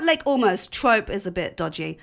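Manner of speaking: ordinary talk, nothing marked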